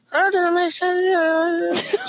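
high, slow squeaky puppet voice; squeaky ventriloquist dummy. Acted. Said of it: "My ventriloquist name is Mina here".

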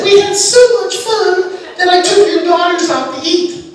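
put-on bubbly teen instructor, all cheer; chirpy young instructor. Young ski instructor telling the dad about dinner, chirpy and high. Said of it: "But she said".

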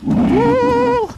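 very high, sustained whoop. Real, not acted. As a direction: whoop after a narrow escape. Whooping after the escape.

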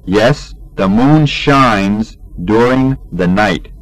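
ordinary talk, nothing marked

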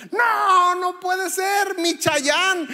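imitating a wail. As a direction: wail like a heartbroken fan, slowly. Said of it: "Empezó a llorar". (lamento exagerado, mucho más agudo y fuerte)

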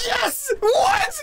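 high, overjoyed cries; excited shriek. Real, not acted. Reacting to plans to hang out, excited shriek. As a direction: squeal in happy disbelief. Both going to Boston.

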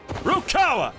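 high, sharp summons; commanding call. Acted. Torturer summons his assistant, calling out.

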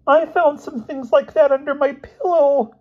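very high, loud squeaky confession; alarmed outburst. A narrator's reading. Boy confessing to the headmaster, high squeaky alarm. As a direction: squeal it in astonishment. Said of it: "Elmer gasped".

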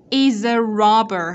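loud, slow "Is a robber", z carried into "a"; teacher models "Is a" as "i-za"; linked sounds. Shown as a demonstration. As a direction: project "Is a robber", linking the first two words. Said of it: "ở đây cô cũng nối âm này".

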